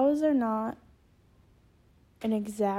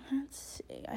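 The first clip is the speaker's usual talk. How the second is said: soft whispered aside to self; whispering; 4 semitones higher, 12 dB quieter than usual. Real, not acted. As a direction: whisper while searching notes. Looking for notes.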